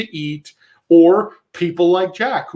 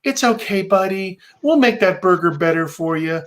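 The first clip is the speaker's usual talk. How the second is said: cloying sing-song reassurance, lifting high at the ends; mock babying voice; toddler talk; 3 semitones higher than usual. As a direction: soothe a grown man like a toddler. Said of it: "toddler language".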